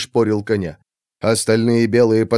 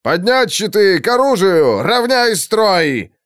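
higher, slow barked commands; gruff barked parade orders; 7 semitones higher than usual. A narrator's reading. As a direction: bark orders at the line. Officer ordering troops.

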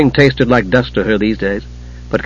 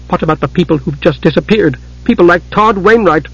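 building anger; 5 semitones higher than usual. Acted. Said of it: "Tika's storm was building".

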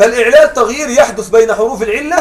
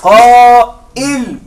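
قائل said very slowly and louder, qaa-'il with a clear hamza; slow, louder قائل with the hamza stressed; slow emphatic demo; 6 dB louder, much slower than usual. Shown as a demonstration. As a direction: announce قائل slowly to the class. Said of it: "ما اسم الفاعل؟".

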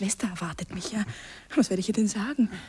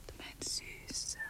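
soft, far-off tender whisper; whispered endearment; 7 dB quieter, much slower than usual. Acted. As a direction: murmur sweetly. Tender endearment after kiss.

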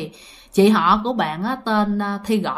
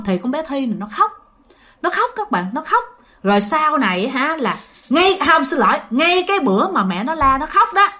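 usual speaking voice

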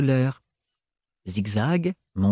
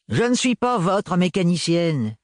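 grumbling; 5 semitones higher than usual. A narrator's reading. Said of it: "grommela Gaëlle".

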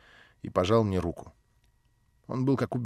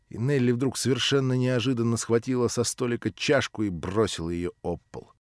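ordinary delivery

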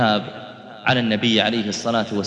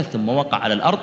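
ordinary delivery

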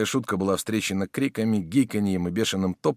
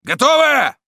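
very high, loud questioning command; full-lung bellow; 8 dB louder than usual. A narrator's reading. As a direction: roar it to check the troops are ready. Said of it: "оравшего во всю силу".

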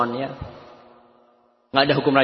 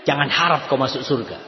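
ordinary talk

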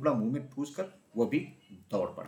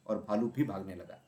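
ordinary delivery

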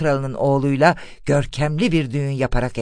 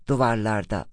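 usual speaking voice

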